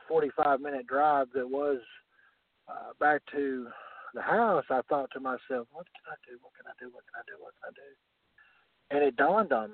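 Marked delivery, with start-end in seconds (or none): 5.7-8.0 hushed, worried muttering under breath, fading to a whisper; whispered to himself. Retold or imitated. Wrestler recalling the drive home, whispering to himself. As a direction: ask yourself in a near-silent whisper, turning it over. Retelling anxious thoughts in car.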